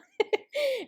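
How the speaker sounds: a quick high burst of laughter; short laugh. Real, not acted. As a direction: laugh at how long he slept. Laughs about him sleeping all morning.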